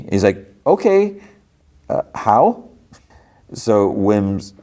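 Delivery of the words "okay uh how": high, uncertain questioning okay; acted-out wary kid, voice rising high; hesitant. Startled boy reluctantly answers droid.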